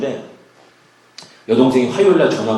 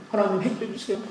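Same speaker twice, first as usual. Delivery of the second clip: weeping plea; 9 semitones higher than usual. Retold or imitated. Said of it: "하면서 울면서".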